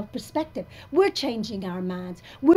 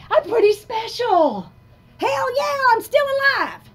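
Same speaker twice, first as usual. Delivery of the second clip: much higher and louder, exultant; triumphant; 12 semitones higher, 9 dB louder than usual. Real, not acted. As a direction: crow it out in triumph. Proud exclamation of survival.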